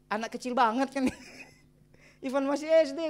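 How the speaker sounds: normal talking voice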